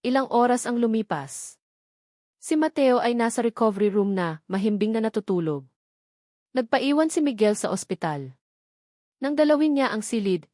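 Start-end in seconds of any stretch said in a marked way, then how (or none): none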